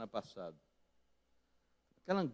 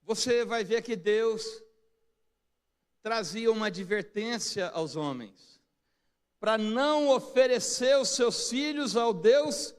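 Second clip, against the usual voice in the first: emphatic pulpit warning; impassioned preaching; 8 semitones higher, 8 dB louder than usual. Real, not acted. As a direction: preach it firmly, voice rising and getting louder. Preaches at full force.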